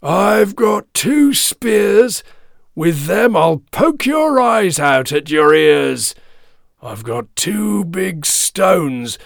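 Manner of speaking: loud, high, slow shouted threats; big hoarse goat. A narrator's reading. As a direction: thunder out each threat hoarsely and slowly. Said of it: "big hoarse voice".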